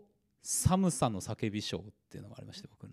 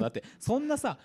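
ordinary talk

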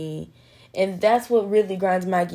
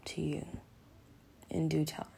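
whispered, soft and close; 3 semitones lower, 8 dB quieter than usual. Acted. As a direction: breathe the words gently like a secret. Soothing self-talk opening.

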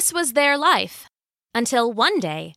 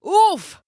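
high, loud "oof" of impact; sudden winded oof; effortful oof; 8 semitones higher, 6 dB louder than usual. Acted. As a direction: grunt as if knocked backwards. Falls with the heavy pot.

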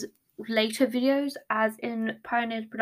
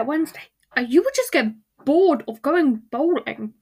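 exasperated rant; 2 semitones higher, 5 dB louder than usual. Real, not acted. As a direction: work yourself up into an incredulous rant. Exasperated rant about bowling.

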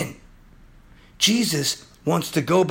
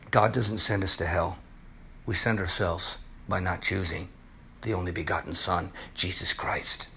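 much lower and softer, solemn; grave warning; 10 semitones lower, 7 dB quieter than usual. Acted. Preacher warning listeners about sin, low solemn voice. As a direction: drop low and sober.

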